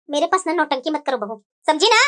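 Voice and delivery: deep-voiced mother-in-law scolding; stern mother-in-law.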